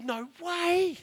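high, loud disbelief; astonished. Retold or imitated. Reacts to news of free car.

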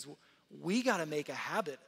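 normal talking voice

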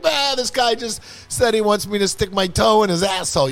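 calling him out. Retold or imitated. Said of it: "she can be like".

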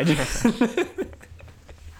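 high laugh. Real, not acted. Laughs at own cheap answer.

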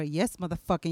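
ordinary talk, nothing marked

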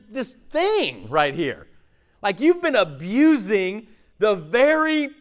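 high, slowing for weight; emphatic rebuke. Acted. Bystander telling man his dog saved him, emphatic rebuke. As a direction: spell it out to someone oblivious.

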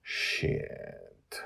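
low, soft curse; muttered curse. Acted. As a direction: mutter it under breath. Cursing quietly in defeat.

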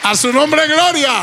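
high, loud call for praise; rally the church; preaching call. Real, not acted. Pastor calling the congregation to praise, loud and high.

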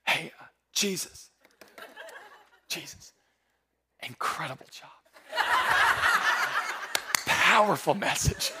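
slow, hushed, nervous murmur; timid interruption. Retold or imitated. Disciple sneaking up to interrupt Jesus, hushed and timid. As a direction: creep up and whisper it apologetically. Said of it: "they just slowly go".

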